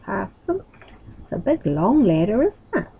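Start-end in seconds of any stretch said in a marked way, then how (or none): none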